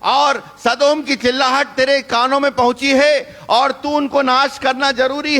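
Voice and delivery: high, urgent pleading sermon voice; fervent preacher crying out; impassioned preaching. Acted. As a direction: plead like a prophet before God. Preaching at full force.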